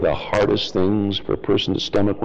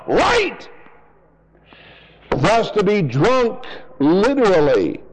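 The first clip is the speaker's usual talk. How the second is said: preaching at full force; 9 semitones higher than usual. Acted. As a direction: thunder it from the pulpit. Fiery sermon on religion.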